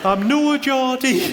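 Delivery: put-on high, slow, drawn-out Sunderland accent; terrible Sunderland accent. Sunderland barmaid objecting to being called Geordie, terrible Sunderland accent. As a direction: stretch out a hammed-up Sunderland accent. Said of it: "that's a terrible accent".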